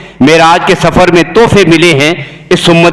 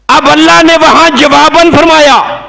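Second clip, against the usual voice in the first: high, ringing declamation; preaching announcement; 8 semitones higher than usual. Real, not acted. Preacher announcing God's reply to worshippers, declaiming. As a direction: herald the answer in a raised pitch.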